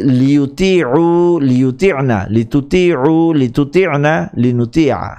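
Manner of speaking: লিউতিউ drawn out slowly, syllable by syllable; slow recitation demo. Shown as a demonstration. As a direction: recite লিউতিউ slowly so students can memorise it. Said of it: "আমি উচ্চারণটি আরেকবার করছি".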